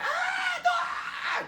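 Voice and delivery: high shrieking scream of terror; very high, loud panicked scream; dying scream. Retold or imitated. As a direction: shriek as you're dragged down. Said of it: "you're screaming like".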